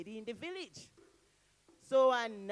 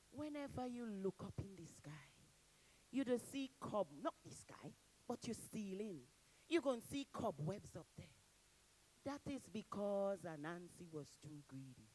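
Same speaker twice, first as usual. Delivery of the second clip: soft, secret storyteller voice; hushed narration; 3 semitones lower, 8 dB quieter than usual. A narrator's reading. Storyteller shares the tale's moral with the audience, hushed. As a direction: lean in and tell it softly.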